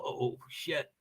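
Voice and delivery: ordinary talk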